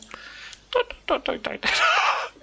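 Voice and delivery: high, rapid stuttered nonsense syllables; squeaky pattering noise ending in a laugh; imitated scurrying noise. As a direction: mimic a sped-up little figure in squeaky syllables. Imitates sped-up settler moving.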